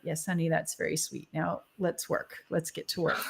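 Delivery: soft, low, unruffled motherly voice; calm spirit guide. Retold or imitated. Ma the guide brushing off her worry, soft and calm. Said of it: "Ma ... is like".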